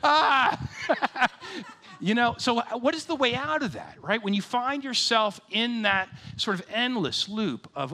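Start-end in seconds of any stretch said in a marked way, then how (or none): 0.0-0.6 much higher and louder, hammed-up alarm; mock panic. Pastor retelling being caught by God, hammed-up panic. 1.3-4.0 laughing at himself. Real, not acted.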